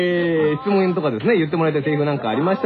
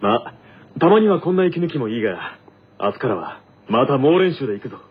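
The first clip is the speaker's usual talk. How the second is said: a lightly voiced, firm captain rallying the team; captain planning practice, then surprised by a mail, lightly voiced; team captain character; 2 semitones lower than usual. In-character radio skit.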